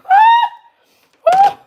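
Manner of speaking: sudden high, loud laughing yelp; startled laughing cry; laughing shout. Real, not acted. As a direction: burst out in a laughing shriek.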